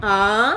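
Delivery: आ held for two beats; long aa. Shown as a demonstration. Teacher showing a long vowel, आ held for two beats. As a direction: stretch आ to double length. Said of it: "दीर्घ स्वर में".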